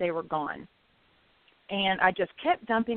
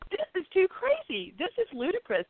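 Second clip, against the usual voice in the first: incredulous; 8 semitones higher than usual. Retold or imitated. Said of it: "So I just was like".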